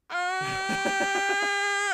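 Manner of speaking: very long high held scream; screaming orc. Acted. Screamer orc interrupting the fight, comically long scream. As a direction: scream on one high note, endlessly. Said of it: "the man who screams".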